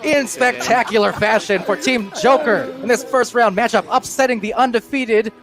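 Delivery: big excited climax call. Real, not acted. Buzzer beater wins the game.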